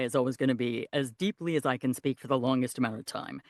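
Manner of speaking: low, deep male lead voice; deep leading man. Shown as a demonstration. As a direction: speak as deep as possible. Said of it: "the main guy".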